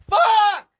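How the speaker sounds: high, loud cry of dismay; exasperated yell. Retold or imitated. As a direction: explode on the one word. Acted dismay at friend already winded.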